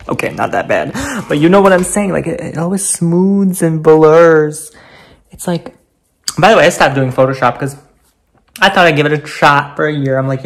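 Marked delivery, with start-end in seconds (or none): none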